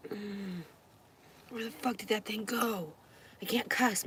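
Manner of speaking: ordinary talk, nothing marked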